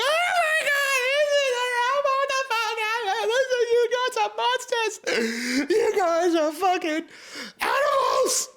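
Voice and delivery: very high sobbing squeal; horrified kid. Retold or imitated. Boy fleeing the cow slaughter, high sobbing wail. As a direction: wail it like a traumatized child running off. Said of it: "And I ran away".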